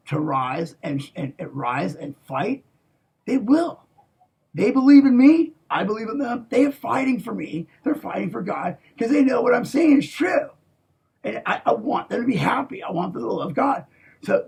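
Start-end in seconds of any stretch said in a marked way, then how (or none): 3.3-10.5 rising fervent shout. Real, not acted. Fervent speech about his troops.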